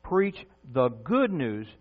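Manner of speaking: "good news" spaced out slowly and deliberately; slow right down to emphasize "good news"; stress on good news. Real, not acted. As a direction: land firmly on each word of "good news". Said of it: "I emphasize the word good news".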